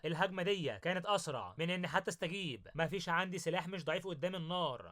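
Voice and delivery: usual speaking voice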